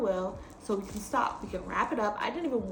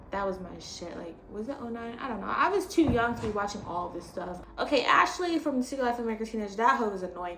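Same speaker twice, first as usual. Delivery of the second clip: ordinary delivery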